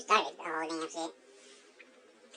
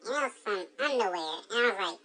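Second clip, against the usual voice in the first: ordinary talk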